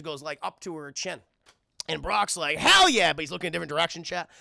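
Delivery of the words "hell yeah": loud, high whoop of approval; acted hyped-up bystander; excited cheer. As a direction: burst out with hammed-up enthusiasm. Brock cheers at the sight.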